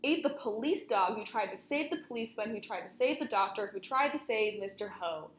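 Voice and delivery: ordinary delivery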